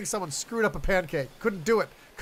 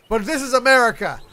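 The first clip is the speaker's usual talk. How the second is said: mock outrage; 4 semitones higher, 7 dB louder than usual. Mock outrage at crepe lover.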